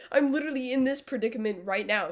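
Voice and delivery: ordinary talk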